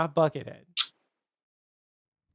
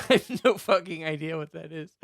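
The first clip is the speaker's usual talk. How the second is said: laughing admission. Real, not acted. Laughing at own ignorance.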